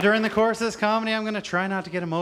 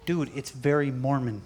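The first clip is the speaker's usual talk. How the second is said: warning friend; 7 semitones lower, 4 dB quieter than usual. Retold or imitated. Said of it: "People were like".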